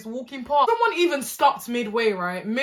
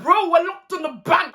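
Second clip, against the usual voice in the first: panicked appeal; 7 semitones higher, 3 dB louder than usual. Retold or imitated. Panicked appeal to live viewers.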